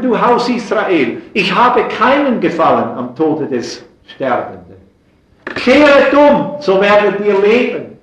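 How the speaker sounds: loud, high impassioned preacher voice; fervent preaching. Acted. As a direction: preach it at full force from the pulpit. Prophet's cry quoted in sermon.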